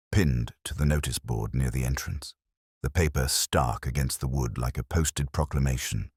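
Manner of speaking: plain reading voice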